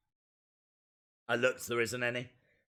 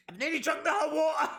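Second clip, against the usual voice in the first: high exclaim; 12 semitones higher, faster than usual. Real, not acted. Suffering from spicy food.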